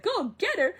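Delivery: high, loud retold outburst; acted high-voiced holler; mock exclamation. Retold indignant echo of order.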